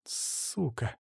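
very soft, venomous hiss; hissed curse. A narrator's reading. Маша sees who is calling, hissing a curse. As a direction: draw out the first sound and spit it softly. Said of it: "прошипела она".

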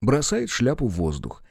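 ordinary talk, nothing marked

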